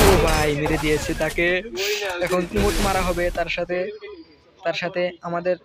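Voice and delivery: lively, rising play-by-play call; esports caster. Acted. Narrates the fight like a caster. (উত্তেজিত খেলার ধারাভাষ্য)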